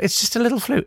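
high, dismissive mock quote; mocking Bolsonaro. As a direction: toss it off like a mocked politician. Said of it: "he calls it the little flu".

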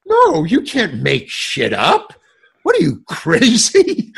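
mock outrage. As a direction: act appalled at the very idea. Imagined outraged reply from script supervisor.

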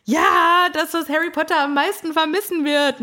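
high, emphatic house-elf; Dobby. Retold or imitated. House-elf confirms Harry's guess, high and emphatic.

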